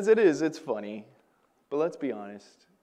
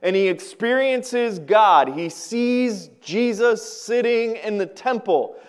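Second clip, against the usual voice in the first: full-force preaching; 5 semitones higher, 6 dB louder than usual. Acted. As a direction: preach it out to the congregation.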